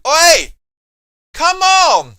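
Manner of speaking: very loud, high yell at the referee; outraged shout. Real, not acted. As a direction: explode in protest at the call. Reacting to a foul live.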